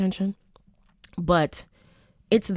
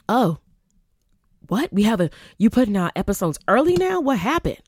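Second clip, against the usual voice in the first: high, quick puzzled fan; confused surprise; 6 semitones higher, 6 dB louder than usual. Retold or imitated. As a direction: ask it baffled and fast. Confused fans quoted.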